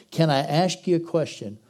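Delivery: usual speaking voice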